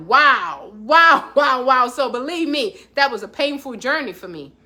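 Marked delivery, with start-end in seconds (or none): 0.1-1.9 stunned disbelief. Retold or imitated. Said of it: "So I was like".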